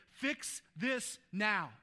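slow, emphatic demand; mock demand. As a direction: Fix this now, spelled out slowly like an impatient order. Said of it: "We want Jesus to step in and say".

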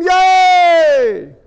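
shouted "Yay!", high and loud; sports fan whooping at a win; mock fan cheer. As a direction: cheer like a fan whose team just won.

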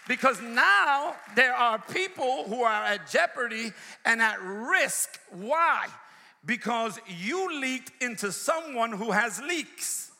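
high, driving sermon delivery; full-force preaching. Real, not acted. Preacher warning against sharing a friend's confidence, full-force preaching. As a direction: preach it hard, stretching the key words.

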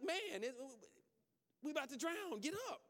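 high, loud panicked yell; frantic disciple. Acted. Disciple yelling at sleeping Jesus, high loud panic. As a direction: shake him awake, panicking. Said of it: "he's like".

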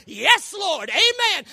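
preacher hollering high praise to the congregation. Real, not acted.

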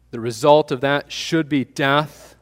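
ordinary talk, nothing marked

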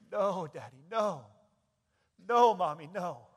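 loud, high pleading cry. Acted. Dying son cries out to his parents, loud pleading wail. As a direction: call out like a child begging a parent. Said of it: "As he cries out".

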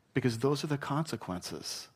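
usual speaking voice

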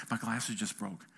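low, soft deadpan admission; acted downcast murmur; dejected. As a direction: report the mishap quietly, pitch dropped. Dejected report to his wife.

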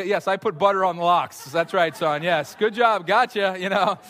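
hammed-up sarcastic good job; sarcastic praise. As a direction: praise the prank with heavy irony.

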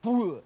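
sudden loud puff of air; imitated rush of breath; imitated whoosh. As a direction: make a quick, high whoosh. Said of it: "I felt the Holy Spirit go".

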